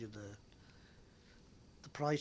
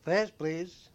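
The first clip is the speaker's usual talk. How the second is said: louder, higher call of a bus conductor; 5 semitones higher, 9 dB louder than usual. Retold or imitated. Conductor calling out fares.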